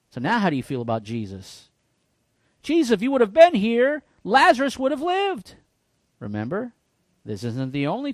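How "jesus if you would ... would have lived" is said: grieving reproach. Retold or imitated. Grieving relative reproaching Jesus.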